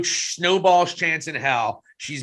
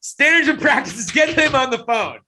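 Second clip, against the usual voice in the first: mock alarm; 6 semitones higher than usual. Mock alarm, calling for censors.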